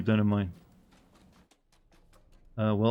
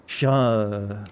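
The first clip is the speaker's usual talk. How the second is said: long, moaned French "Sha"; moaning French; 3 semitones lower than usual. Pretend. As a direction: drag "Sha" out as a mock French moan. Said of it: "French is a very moaning language".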